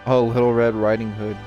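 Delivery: ordinary talk, nothing marked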